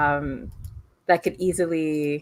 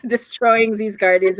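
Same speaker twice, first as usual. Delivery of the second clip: usual speaking voice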